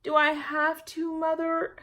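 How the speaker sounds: put-on high, slow child's whine; a child dodging chores; reluctant girl pleading; whiny little girl. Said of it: "Jamaica answered".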